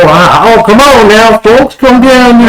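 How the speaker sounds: high, slow Southern bayou voice; southern bayou drawl. Retold or imitated. Mimicking the fake Southern guide luring the group in, Southern drawl. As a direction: ham up a folksy Southern con man. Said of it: "who has a southern, like, a bayou".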